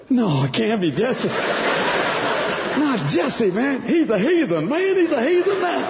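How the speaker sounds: high-pitched, incredulous objection; hammed-up disbelief, pitch soaring at the end; retold protesting mother. Acting out mom protesting to God.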